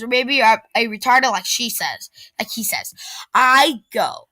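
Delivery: ordinary delivery, nothing marked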